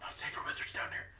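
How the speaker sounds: high breathless whisper; gasp, then whisper the warning. Pretend. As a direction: hiss it like a secret. Whispered aside.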